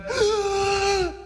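long, high, straining gasp; labored intake of breath, acted out; deep gasp. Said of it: "took a deep breath".

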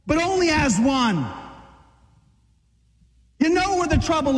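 high, urgent exhortation pushing the men to act; preaching at full force. Acted. Pastor urging the men into action, preaching at full force. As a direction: fire them up from the pulpit.

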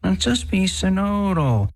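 high, slow mocking whine; whiny voice. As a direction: drag it out like a sulky child. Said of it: "That whiny voice".

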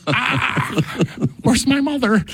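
put-on tiny, very high child voice; mock frightened child. Scared child in confessional wanting mother, mock frightened squeak. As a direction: squeak it out like a panicked little kid, played for laughs.

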